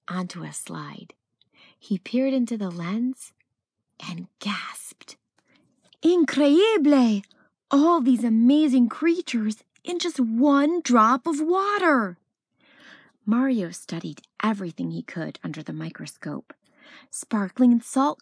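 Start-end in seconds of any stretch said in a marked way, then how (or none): 6.0-12.2 loud, high gasp of wonder; amazed child. Acted. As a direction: marvel at the tiny creatures.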